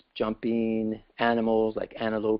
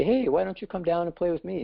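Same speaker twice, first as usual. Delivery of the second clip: higher-pitched friendly call to come play; mild storyteller's frog voice, calling out; eager invitation; 8 semitones higher than usual. A narrator's reading. Frog in the well invites visiting creatures down, eagerly.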